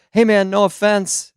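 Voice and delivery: high-pitched mock stranger; stranger's approach. Stranger coming up to the comedian, lightly put-on high voice.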